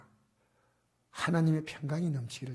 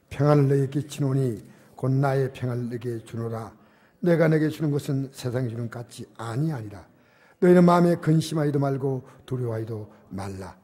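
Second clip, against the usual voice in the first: loud, measured Bible recitation; scripture reading; 8 dB louder than usual. Acted. Pastor leads congregation in reading the verse, scripture reading. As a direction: lead the verse firmly and loudly.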